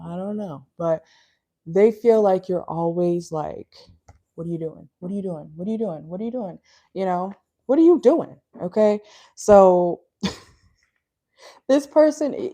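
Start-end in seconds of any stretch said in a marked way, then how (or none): 4.3-6.6 soft, repeated prying question; nagging watcher. Acted. Acts out Virgo constantly checking up. 7.7-8.4 loud, high, insistent question; mock busybody bursting out; demanding watcher. Same question, now loudly demanded.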